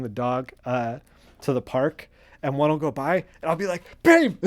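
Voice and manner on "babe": sudden high excited yell of "Babe!"; excited shout. Retold or imitated. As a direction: call out to your partner thrilled at a passing car. Excited shout seeing a car.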